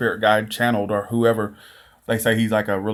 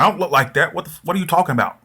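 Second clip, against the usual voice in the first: imitated attitude; 7 semitones higher, faster than usual. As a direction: retort with attitude, imitating an offended spirit. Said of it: "attitude with her".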